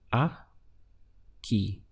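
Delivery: "aquí" split into two parts, "a... quí", with a long gap; syllable by syllable. Shown as a demonstration. Teacher breaks the word down for learners, "aquí" in two separate syllables. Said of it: "with an accent over the I".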